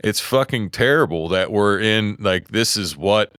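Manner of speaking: normal talking voice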